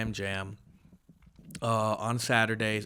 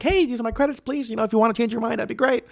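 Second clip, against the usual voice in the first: high-pitched, ingratiating request; mock yourself begging a booker nicely; polite pleading; 10 semitones higher, faster than usual. Retells his pleading emails to booker.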